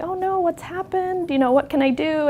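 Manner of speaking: high, concerned quote of oneself; high-voiced sympathetic reaction; retold concern. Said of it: "she was just sobbing".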